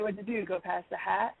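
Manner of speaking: soft retold question, voice dropped well down. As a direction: say it low and gentle, just for her. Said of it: "And in a very soft voice".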